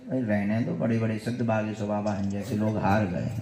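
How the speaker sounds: usual speaking voice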